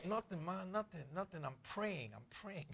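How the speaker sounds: small, soft, hasty excuse; praying under breath. Retold or imitated. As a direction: imitate a caught kid murmuring innocence. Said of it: "You better pray louder".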